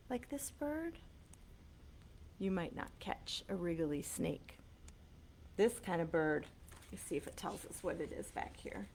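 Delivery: ordinary delivery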